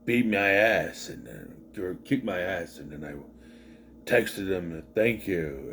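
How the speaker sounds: low, slowed co-worker voice, lightly imitated; retold co-worker. Reviewer quoting the drunk co-worker's confession, low retold voice. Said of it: "He's just like".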